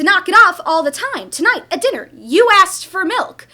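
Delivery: loud, accusing scold; exasperated mother scolding loudly; angry scolding. Acted. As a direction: hammer the point home.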